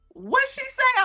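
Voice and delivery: over-the-top puzzled voice; exaggerated confused face. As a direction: play up the audience's confusion. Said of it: "somebody's face literally go".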